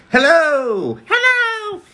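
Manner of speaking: very high squeaky hello; cartoon hello. Greeting chat in a high cartoon voice. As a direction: pitch it way up, cartoon style. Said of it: "I'm Mickey Mouse".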